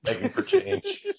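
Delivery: high, slow, laughing squeak; laughing mock voice. Mocking voice while laughing.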